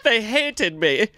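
put-on high, drawn-out Swedish kid voice; pitiful mock-Swedish complaint, high and slow; Swedish exchange kid. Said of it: "blue-eyed Swedish".

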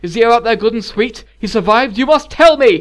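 loud, high, desperate questioning; frantic demanding. A narrator's reading. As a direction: plead and demand, building to a cry. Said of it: "he said, practically shaking her".

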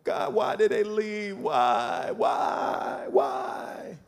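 a hushed, breaking cry to God; mock crying plea. Preacher voicing a hurt believer, soft tearful plea. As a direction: whimper it like someone grieving.

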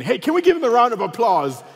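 mock crowd rallying. Friend rallying passengers to embarrass him, mock announcement. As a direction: play the hype man for a prank. Friend calls plane to applaud.

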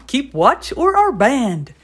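papa chicken on guard. Acted. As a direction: stand guard and draw the order out. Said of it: "said pa".

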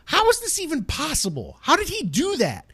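put-on high, astonished disbelief at the kids' moves; amazed disbelief. Gamer marveling at young players, in high disbelief. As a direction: gasp it out in awe. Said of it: "I would just go".

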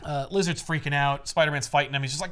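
ordinary talk, nothing marked

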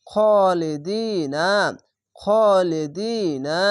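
very slow, measured chant of খালিদিনা with long vowels; drawn-out recitation. Shown as a demonstration. Teacher repeating খালিদিনা for students, slow and drawn out. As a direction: stretch খা and দি in খালিদিনা by one alif. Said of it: "এক আলিফ টেনে পড়বেন".

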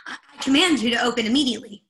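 loud, fast, commanding order. Acted. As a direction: bark the command. Ordering the door.

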